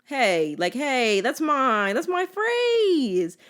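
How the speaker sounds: rising to a high, drawn-out whine; playfully protest, stretching the final word; mock indignation. To mom who took the phrase, mock indignant.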